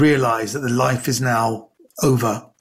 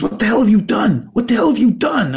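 panicked agent; 7 semitones higher than usual. Retold or imitated.

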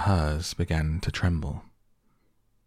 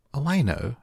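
higher, eager exclamation; Alice exclaims, higher and eager; exclaiming; 9 semitones higher than usual. A narrator's reading. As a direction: blurt it out brightly, much higher. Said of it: "exclaimed Alice".

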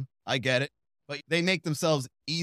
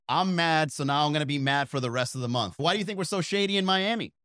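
ordinary talk, nothing marked

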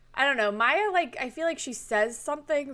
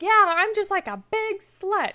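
making a scene; 10 semitones higher, 5 dB louder than usual. Retold or imitated. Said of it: "she starts making a big scene".

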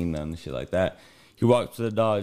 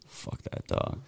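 muttered aside; 9 dB quieter, slower than usual. Real, not acted.

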